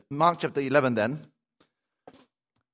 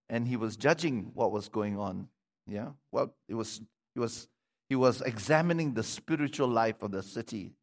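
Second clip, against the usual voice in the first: normal talking voice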